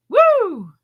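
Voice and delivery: put-on high loud old lady whoop; mock old lady cheer; old lady woo. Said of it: "You sounded like the oldest lady".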